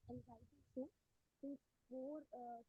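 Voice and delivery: muffled, as if hand over mouth; faint, muffled voice. Real, not acted. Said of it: "your sound is muffled".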